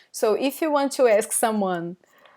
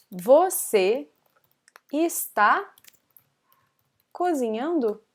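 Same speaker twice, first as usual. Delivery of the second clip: word by word; 3 semitones higher than usual. Shown as a demonstration. Teacher translating a student's question, word by word. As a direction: separate words with long pauses.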